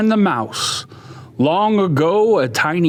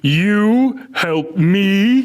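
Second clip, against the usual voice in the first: scoffing lion; 3 semitones higher, much slower than usual. Acted.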